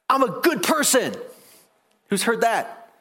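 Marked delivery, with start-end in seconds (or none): 0.1-1.2 mock smug boast. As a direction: sneer out the boast. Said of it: "He didn't say".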